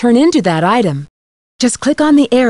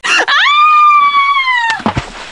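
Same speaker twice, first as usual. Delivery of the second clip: scream. Acted. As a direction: let out a piercing, sustained scream. Startled scream among game lines.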